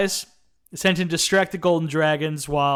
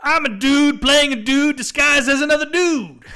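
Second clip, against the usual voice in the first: higher, louder, slower put-on character voice; mock movie-character delivery, pitched up and slow; Kirk in character; 10 semitones higher, 7 dB louder than usual. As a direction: ham up the in-character line, drawn out. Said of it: "Kirk responding still in character".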